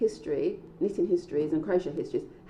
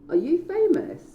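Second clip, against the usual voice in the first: excited; 12 semitones higher, 6 dB louder than usual. Acted. Excited question to new acquaintance.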